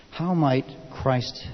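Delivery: ordinary talk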